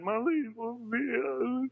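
high, slow mock sob; crying. Boy clinging to his mama, high slow mock crying. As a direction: wail it high and slow like a hurt kid. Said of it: "I was crying".

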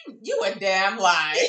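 loud, laughing call-out of a liar; loud mock accusation. Calling out a lying suspect, loud and laughing. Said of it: "You'd be like".

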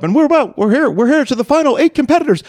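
high, booming ring announcer. Retold or imitated. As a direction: hype up the finalists like an emcee. Retelling the tournament announcer.

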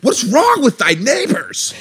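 high, drawn-out mock outcry; slow, incredulous wail of complaint; exasperated. Wife protests about complaining neighbours, exasperated.

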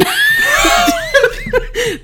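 high screaming laugh; cackling shriek. Real, not acted. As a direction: howl with laughter. Laughing at own text.

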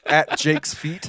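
high laughter, slowly gasping words; laughing. Real, not acted. Bursts laughing at the joke.

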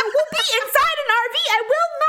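high, laughing indignant protest. Retold or imitated. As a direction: insist you're fine, ruffled. Mimics own protest to husband.

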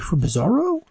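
high, lightly voiced surprised Bizarro. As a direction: ask as if no one ever gave him anything.

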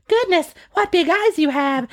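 put-on high-pitched storybook girl. Girl remarking on grandmother's big eyes, high mock wonder. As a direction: play the amazed girl, pitched well up. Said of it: "and she says".

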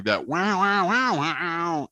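sing-song "wow" mimicking a guitar, rising high; guitar wow imitation. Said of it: "you'll hear what I love doing".

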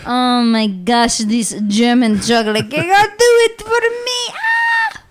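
hammed-up German rapture; German accent. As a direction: build to a squealed German climax. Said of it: "do this in a German accent".

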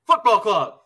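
loud, high announcer call; stadium announcer. Retold or imitated. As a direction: boom it like a stadium PA.

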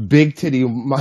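breaking into laughter mid-word; cracking up. Real, not acted. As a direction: lose it laughing halfway through. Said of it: "let me compose myself".

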